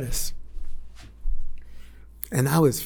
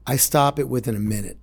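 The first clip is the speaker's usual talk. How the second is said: normal talking voice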